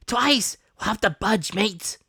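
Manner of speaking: alarmed shout. Acted. Pirate urging retreat.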